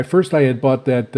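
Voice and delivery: usual speaking voice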